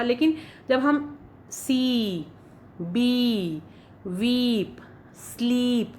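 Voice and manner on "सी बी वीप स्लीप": सी, बी, वीप, स्लीप with the ई stretched even longer; extra-long ee. Shown as a demonstration. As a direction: hold the double ई long. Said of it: "ई है इसको हम थोड़ा ज़्यादा लंबा खींच के बोलते हैं". (डबल ई को और लंबा खींचें)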